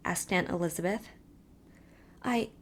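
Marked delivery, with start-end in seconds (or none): none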